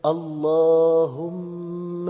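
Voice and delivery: slow sung call, lingering on the m; gentle drawn-out invocation. Shown as a demonstration. Preacher showing youth how to call on God, slowly. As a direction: stretch اللهم very long on a steady chanted note. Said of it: "فشوفوا يا شباب نقولها إزاي".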